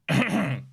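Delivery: imitated throat-clearing cough; pointed cough. Imitating Connor coughing while they talk, mock attention-seeking cough. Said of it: "just coughing in the background".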